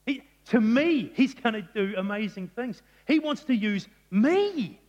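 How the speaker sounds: Mary can't believe she's chosen, building in wonder; amazed Mary. Retold or imitated. Preacher voicing Mary's astonished joy, loud and high. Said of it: "It's as though she's going".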